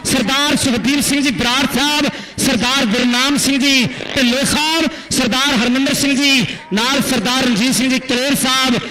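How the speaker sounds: loud stadium play-by-play; live commentary. Acted. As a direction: announce it to the whole ground. (ਜੋਸ਼ੀਲੀ ਕੁਮੈਂਟਰੀ)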